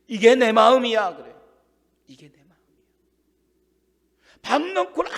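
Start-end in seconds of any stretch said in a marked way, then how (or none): 0.1-1.1 loud declaration. Acted. God's heart declared. 2.1-2.5 the same words again, much softer, almost breathed; soft repeat. Acted. Preacher repeating God's words to the congregation, much softer.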